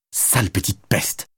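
soft, slow, contemptuous aside; muttered inner insult. A narrator's reading. Madeleine cursing the rude teen in her head, soft and slow. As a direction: spit it under your breath, slowly. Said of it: "pensa-t-elle très fort".

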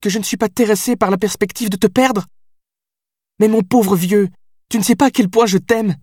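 high, strained, indignant; hurt fury spilling over; high indignant reproach to her lover; worked-up anger. Acted. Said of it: "Elle était remontée contre moi".

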